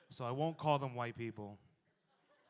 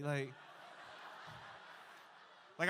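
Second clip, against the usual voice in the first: ordinary delivery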